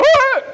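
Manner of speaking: short, very high, loud yell; wordless high shout through a mouthpiece; quarterback's yell. Acted. Quarterback demanding the ball, wordless yelling. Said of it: "And then he go".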